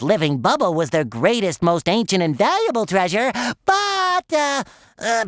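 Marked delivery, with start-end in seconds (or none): 3.6-5.2 awkward hesitation. Acted. Stalls before awkward admission about bubble.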